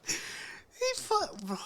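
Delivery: loud, high shriek breaking into laughter; shocked laughing outburst. Real, not acted. Listener hears about dad in the room, shocked laughter. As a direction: crack up on a drawn-out high exclamation.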